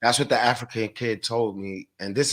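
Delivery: ordinary talk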